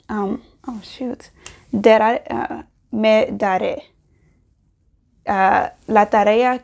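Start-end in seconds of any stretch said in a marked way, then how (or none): 0.1-1.3 self-annoyed whisper under the breath; soft frustrated whisper; whispered aside. Real, not acted. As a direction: mutter it to yourself in a whisper.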